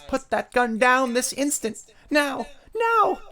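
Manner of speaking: high, loud frantic mom voice; tearful outcry. Acted. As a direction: order desperately, climbing to a shriek. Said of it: "she cried, tears welling up in her eyes".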